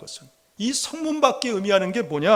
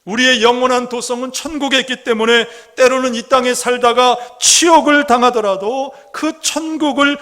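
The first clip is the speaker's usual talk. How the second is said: loud, raised-pitch sermon delivery; forceful preaching; 4 semitones higher, 5 dB louder than usual. Real, not acted. Preacher urges believers to live toward heaven, forceful preaching. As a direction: press the point home at full force.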